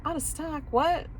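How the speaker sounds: high-pitched disbelieving question; incredulous echo. Retold or imitated. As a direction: repeat it back in high disbelief. Her stunned reply to the news.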